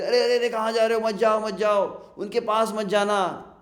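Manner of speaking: loud, high-pitched acted alarm; urgent warning. Townsfolk warning the two devotees away, urgently calling out. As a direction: call out to stop someone in their tracks.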